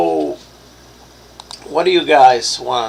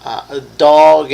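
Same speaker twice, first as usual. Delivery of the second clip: ordinary delivery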